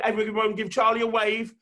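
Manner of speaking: ordinary talk, nothing marked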